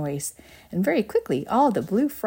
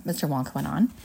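ordinary delivery

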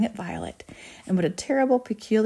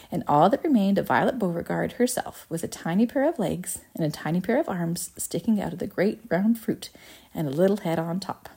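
ordinary delivery, nothing marked

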